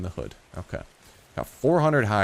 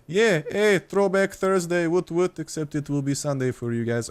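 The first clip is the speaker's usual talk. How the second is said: high cartoon character voice, stretched out on the catchphrase; Popeye; 8 semitones higher than usual. Shown as a demonstration. Streamer hailing throwback Thursday for fans, high cartoon impression. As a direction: bring back the silly high-pitched character voice. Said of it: "random-ass accents".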